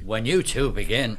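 high, loud interruption; abrupt. A narrator's reading. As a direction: butt in, impatient to go. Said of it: "McCoy said abruptly".

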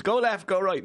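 mock dog commands to a stent. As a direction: bark it like training a dog, lightly. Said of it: "teaches like a dog and goes".

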